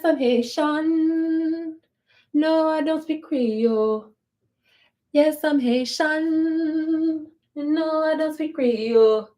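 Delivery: normal talking voice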